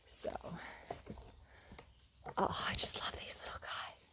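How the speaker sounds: tender hushed whisper; softly adore them in a whisper. Real, not acted. Whispering over small pine cones.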